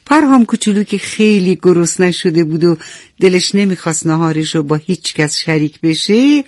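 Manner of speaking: ordinary delivery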